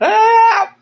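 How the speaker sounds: high yelp of help, put on in panic; retold scream for help. Friend outside at night screaming to the house, high panicked yell. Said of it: "he's just screaming".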